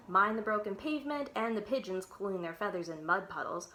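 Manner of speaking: ordinary delivery, nothing marked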